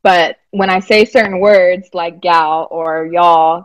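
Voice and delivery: ordinary talk, nothing marked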